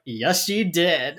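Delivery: very slow, unbothered confirmation; dry deadpan. Retold or imitated. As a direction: shrug it off flatly and slowly. Emily dryly confirming the macing.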